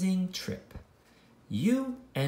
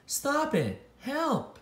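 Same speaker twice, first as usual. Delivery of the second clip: much higher, frightened little voice; scared cry for help; 10 semitones higher, slower than usual. Acted. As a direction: squeal for help as you're spun round. Character being spun cries out.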